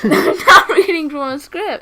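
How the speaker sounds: laughing protest. Real, not acted. Guest denying co-host's tease, laughing loudly.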